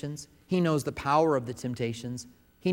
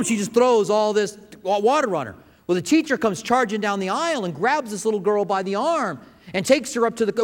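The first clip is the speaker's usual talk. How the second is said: higher and louder, building excitement; excited storytelling; 7 semitones higher, 5 dB louder than usual. A narrator's reading. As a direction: tell the climax with rising energy.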